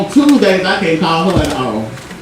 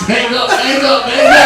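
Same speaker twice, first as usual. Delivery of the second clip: rising frantic plea; 4 semitones higher than usual. Retold or imitated. Begging mama to hang up.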